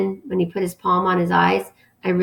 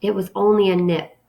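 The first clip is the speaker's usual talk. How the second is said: plain reading voice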